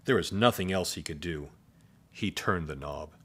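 usual speaking voice

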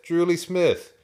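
Drew Lee Smith in one go, high and loud; high, loud, unbroken "Drew Lee Smith"; brisk confirmation. A narrator's reading. Farmer says it again briskly.

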